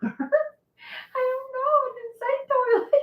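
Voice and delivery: high squeaky laughing voice. Real, not acted. Cracking up over saying toilet. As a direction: laughs it out, barely holding words.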